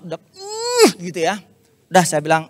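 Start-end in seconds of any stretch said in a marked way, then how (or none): 0.4-0.9 angry huff. Retold or imitated. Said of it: "Dia kayak, udah emosi banget".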